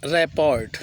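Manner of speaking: "रिपोर्ट" said rap-port with sounded t; as spelled. Shown as a demonstration. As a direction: loudly sound out "रिपोर्ट" to the final t. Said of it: "रिपोर्ट लिखते हैं".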